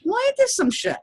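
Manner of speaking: mock disbelief at the students; high, cartoonish "ain't this some shit"; exasperated. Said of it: "so I'm like".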